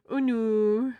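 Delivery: mock horror at own pun; loud mock dismay. Real, not acted.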